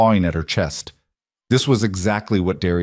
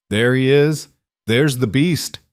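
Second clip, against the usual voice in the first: gloating, raised voice; 5 semitones higher than usual. Acted. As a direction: relish every word. Villain goading the hero.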